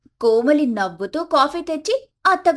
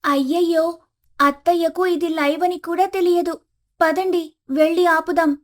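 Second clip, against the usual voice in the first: higher voice, anxious and quick; a young wife fretting, pitched up; daughter-in-law urging her husband to stop mother-in-law, higher and anxious; Komali, worried daughter-in-law; 6 semitones higher than usual. Acted.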